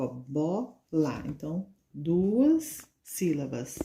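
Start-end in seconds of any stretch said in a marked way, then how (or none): 0.1-1.2 "bola" slowly as two beats, "bo", "la"; short gap between "bo" and "la" in "bola"; split into syllables. Shown as a demonstration. Teacher showing children two mouth openings, "bola" split in two. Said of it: "você abre duas vezes a boca".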